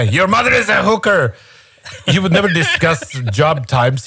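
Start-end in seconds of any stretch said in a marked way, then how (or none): 0.0-1.3 high mock taunt; hammed-up playground insult; taunting a Pakistani guy in a mock insult. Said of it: "if you say that to a Pakistani guy".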